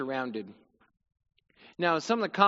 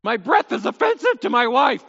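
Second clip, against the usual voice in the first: higher and louder, overdone lament; mock lament; 7 semitones higher, 9 dB louder than usual. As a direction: moan the complaint, hamming it up. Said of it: "quoting Job in mock lament".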